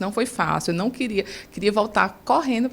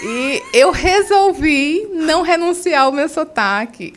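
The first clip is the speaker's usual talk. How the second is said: laughing proudly; 7 semitones higher, 4 dB louder, slower than usual. Real, not acted. Proud claim about keeping accent.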